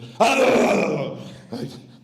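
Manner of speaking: a loud, high mock blare of sound; imitated blast of noise. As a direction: burst out like a band kicking in full volume. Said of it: "it suddenly went".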